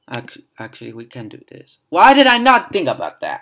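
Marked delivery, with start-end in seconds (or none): 1.9-3.4 exasperated. Real, not acted. Self-exasperation.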